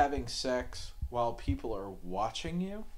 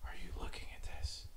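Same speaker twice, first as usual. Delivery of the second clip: whispered question; hushed, breathy nudge in the dark; 8 dB quieter, faster than usual. Retold or imitated. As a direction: whisper so nobody hears. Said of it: "And she goes".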